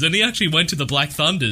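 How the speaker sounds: ordinary delivery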